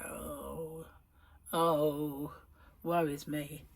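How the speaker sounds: lightly hammed-up woe, soft and slow; a hint of melodramatic sighing; mock lament. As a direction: play a gentle mock tragedy. Mock lament over figures.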